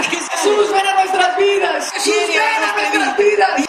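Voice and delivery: high, fervent acted prayer; converts' plea. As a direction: call out as a crowd of penitents. Voices converts praying at altar.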